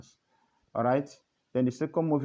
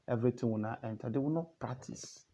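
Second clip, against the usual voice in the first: normal talking voice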